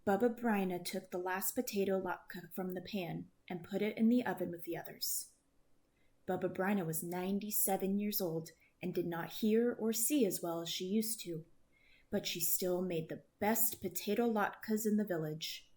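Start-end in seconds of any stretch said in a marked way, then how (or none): none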